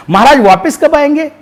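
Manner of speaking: lightly voiced jester asking the king when he returns; eager jester. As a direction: ask it wide-eyed like a simple fool. Jester questions dying king.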